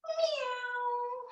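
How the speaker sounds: high drawn-out meow; cat meow. Shown as a demonstration. Teacher showing the kids a cat sound, high meow. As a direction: mew like a little kitten. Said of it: "or meow".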